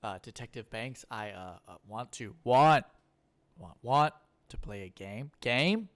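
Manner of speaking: halting stammering Jigsaw; Jeff Goldblum. Retold or imitated. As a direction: stammer through it, hesitant. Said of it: "Jeff Goldblum to be the Jigsaw voice".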